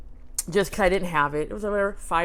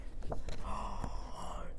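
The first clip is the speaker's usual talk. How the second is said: slow, hushed gasp; long whispered gasp of wonder; awed gasp; 11 dB quieter than usual. Pretend. Revealing a surprise find to viewers, awed gasp.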